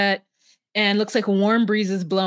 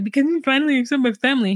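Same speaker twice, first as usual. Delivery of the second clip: higher, rushed imitation of crying; mock tearful; 4 semitones higher, much faster than usual. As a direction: blurt it out as if crying. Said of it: "he starts to cry".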